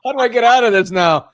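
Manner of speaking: high, loud, laughing through words. Real, not acted. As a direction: laugh while you plead. Caught out, laughing.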